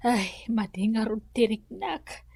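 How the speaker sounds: whispered, sick and weak. Acted. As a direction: barely voice it from the sickbed. Sick old woman answers weakly. (agar-arasaas a nakapuy)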